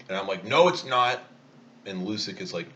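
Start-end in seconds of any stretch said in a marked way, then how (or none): 0.4-1.2 louder, higher flat denial; defiant shout. Retold or imitated. Boy shouting back at the lifeguard, defiant. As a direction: refuse like a stubborn kid. Kid defies the lifeguard loudly.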